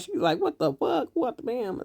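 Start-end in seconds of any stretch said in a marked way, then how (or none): 0.4-1.1 imitating her, pitched way up in outrage; a squealed, furious what-the-fuck; high mock shriek of outrage; mock outraged shriek. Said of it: "She get mad". 1.1-1.8 same outraged phrase, dropped quieter with the bleep word; muted mock outrage, bam for the swear; softer self-censored echo. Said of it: "She get mad".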